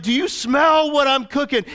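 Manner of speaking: high, loud wrestler catchphrase; Peter as the Rock. Retold or imitated. Peter shouts the Rock's catchphrase to the people. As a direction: hype the crowd with the catchphrase. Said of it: "He's like".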